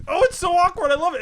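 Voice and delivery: high, loud, gushing delight; delighted cringing. Retold or imitated. Fan recalling an awkward scene, gleefully excited. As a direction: squeal over how awkward it is.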